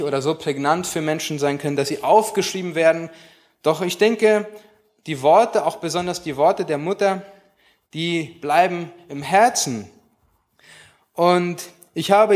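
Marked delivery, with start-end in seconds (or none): none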